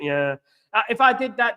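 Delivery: ordinary talk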